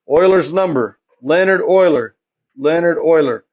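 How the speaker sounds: normal talking voice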